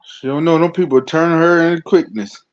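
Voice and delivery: usual speaking voice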